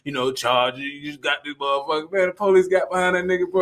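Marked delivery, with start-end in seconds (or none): 0.0-2.1 low, quiet, casual show-off; bragging cousin. Retold or imitated. Friend mimics cousin claiming a borrowed Charger, low-key brag. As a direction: imitate a cousin boasting offhand.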